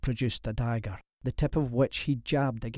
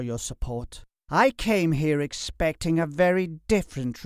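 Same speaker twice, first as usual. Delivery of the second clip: raised, forceful lordly voice; higher, louder, accusing; haughty reproach; 5 semitones higher, 5 dB louder than usual. Acted. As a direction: lecture him as an indignant nobleman. Haughty lord confronts Bella's father.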